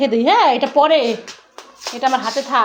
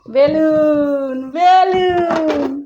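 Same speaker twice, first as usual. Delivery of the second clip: drawn out; much slower than usual. Acted. Mother stretches word for toddler.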